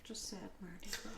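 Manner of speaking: sad whispered aside; whispering. Real, not acted. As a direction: breathe it out, mournful. Mournful aside about birthday.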